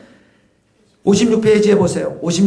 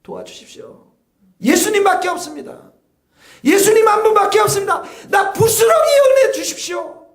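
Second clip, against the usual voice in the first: desperate woman pleading; 8 semitones higher than usual. Acted. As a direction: beg as if nothing else is left. Voicing the woman's desperate plea. (간절히 울부짖는 여인의 목소리)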